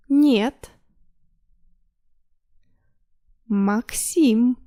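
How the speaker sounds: drawn-out words with long pauses. Shown as a demonstration. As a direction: say each word slowly and firmly for repeating. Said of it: "a little slower".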